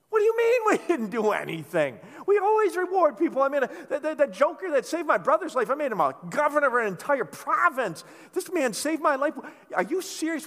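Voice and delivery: loud, high, outraged disbelief; the king demanding answers from his servants, indignant and loud. Acted. King scolding his servants, high and loud.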